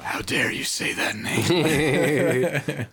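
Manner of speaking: slow, stunned royal outrage; outraged king. Pretend. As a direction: rebuke them, drawn out. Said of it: "I'd be like".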